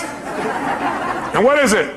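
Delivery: slow, laughing "what is it" to the crowd. Real, not acted. Asking the audience the punchline, laughing. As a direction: let the joke land with a laugh.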